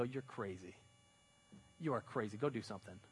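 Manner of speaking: dismissive. Retold or imitated. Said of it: "And they go".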